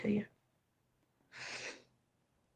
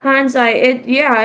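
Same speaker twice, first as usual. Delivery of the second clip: calling out; 3 semitones higher, 10 dB louder than usual. Real, not acted. Calling out to a viewer.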